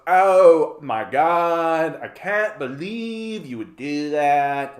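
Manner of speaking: put-on drawn-out Valley Girl disbelief; loud opening, slow Valley Girl whine. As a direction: stretch the vowels like an appalled Valley Girl. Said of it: "Valley Girl all the way".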